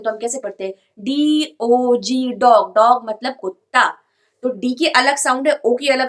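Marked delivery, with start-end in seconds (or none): 1.0-2.7 "डी ओ जी" letter by letter, very slow, then "डॉग" blended and louder; sounding out a word, then saying it whole; spelling it out. Shown as a demonstration. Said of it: "जब हम इसको break करके पढ़ेंगे".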